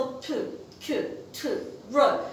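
"ب، ك، ت، ر" as short isolated consonants, with pauses between; demonstrating consonant sounds. Shown as a demonstration. Teacher contrasting consonants with vowels, isolated consonant sounds "ب، ك، ت، ر". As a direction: sound out "ب، ك، ت، ر" one by one, mostly voiceless bursts. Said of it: "من ألفظ".

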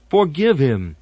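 urgent plea on Forgive; pleading. Acted. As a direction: stronger pleading call. Blood drops plead repeatedly.

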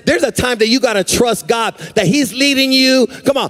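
climbing, sustained sermon cadence; impassioned preaching. Real, not acted. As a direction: preach it with mounting urgency. Preaches at full force.

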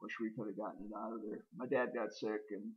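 ordinary delivery

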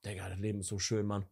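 leise, tief und entspannt, völlig satt; verträumt zufrieden. Retold or imitated. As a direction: wie satt im Bett liegend seufzen. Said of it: "denkst so". (leise, tiefe Zufriedenheit)

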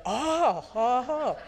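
high, loud "Oh, oh, oh" of sudden understanding; aha realization. Retold or imitated. Dutch audience suddenly understanding vip vop, excited aha. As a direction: exclaim like the penny just dropped. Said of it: "They go".

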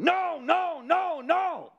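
high, loud panicked screams of no; high desperate shrieking no; terrified screaming. Retold or imitated. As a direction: scream it out in terror at the vision. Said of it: "I began screaming".

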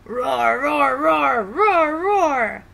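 noisy pride of lions roaring; loud lion roaring. Acted. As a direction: roar the words loudly like young lions. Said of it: "roaring loudly".

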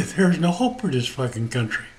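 ordinary talk

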